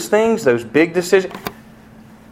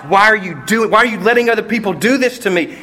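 put-on pleading child; 7 semitones higher than usual. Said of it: "Anger, confusion, doubt".

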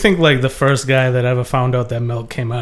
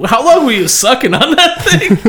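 laughing tease; 13 semitones higher, 3 dB louder than usual. Acted. Teasing the milk drinker.

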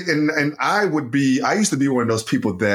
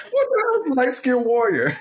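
हँसी में डूबा अविश्वास; laughing disbelief; 11 semitones higher than usual. Real, not acted. Friend laughing at phrase.

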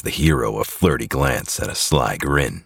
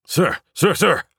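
much higher, frantic repeated sir; stammering. A narrator's reading. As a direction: stumble over the words in a panic. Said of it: "stammers the hero".

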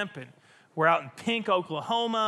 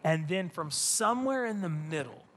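ordinary talk

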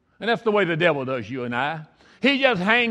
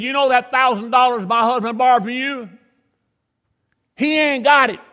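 higher, louder, taunting call; yelling across; 5 semitones higher, 5 dB louder than usual. Retold or imitated. Wife yelling to the neighbour about the debt, loud and blunt. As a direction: shout it over to the neighbour, no apology.

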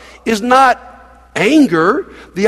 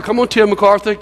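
ordinary talk

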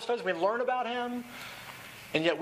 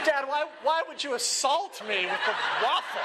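mock crying; 8 semitones higher than usual. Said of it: "she's crying like".

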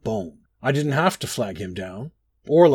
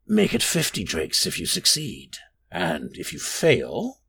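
rasping, sinister stranger making a counter-offer; low rasping voice; 6 semitones lower than usual. A narrator's reading. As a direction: start measured, then sink lower and slower. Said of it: "in a low, rasping voice".

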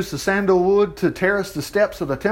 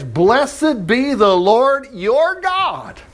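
slow, drawn-out scripture reading; slow, solemn scripture reading; slower than usual. Shown as a demonstration. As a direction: read it slowly, weighty and solemn. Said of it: "Here it is".

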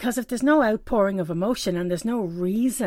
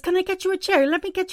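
helpful woman; 11 semitones higher, 3 dB louder, faster than usual. Retold or imitated. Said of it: "She runs over and says".

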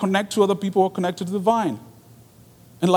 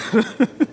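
high short laugh; 5 semitones higher than usual. Real, not acted. Laughs after singing.

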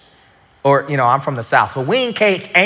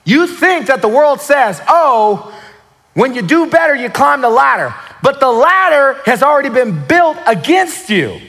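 higher, louder preacher build; preaching at full force; 8 semitones higher, 3 dB louder than usual. Acted. Preacher stirring the congregation, rising force.

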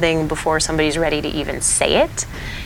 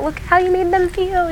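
high, pointed teacher voice; gentle teacher; 14 semitones higher than usual. Retold or imitated. Teacher to the boy about classmates, high gentle reproach. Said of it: "I'll point out".

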